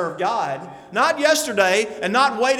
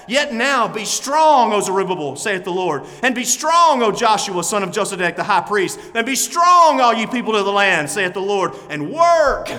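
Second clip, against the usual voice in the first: preaching at full force; 5 dB louder than usual. Acted. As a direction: thunder the command to the people. Reads scripture at full preaching force.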